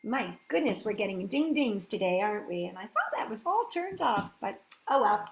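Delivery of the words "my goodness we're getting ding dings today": ordinary delivery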